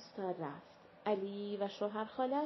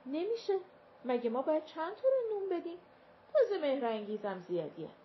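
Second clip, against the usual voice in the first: curt refusal; 6 semitones higher, 4 dB louder, faster than usual. A narrator's reading. Mother refusing the servant.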